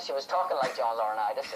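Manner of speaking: ordinary delivery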